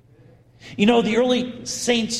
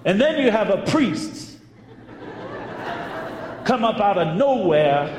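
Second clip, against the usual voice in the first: Southern drawl; 3 semitones higher than usual. Retold or imitated. Friend recounting the blessing to birthday woman, imitated Southern drawl. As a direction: play a Southern woman testifying, lightly drawled. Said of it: "in her thick Southern Louisville drawl".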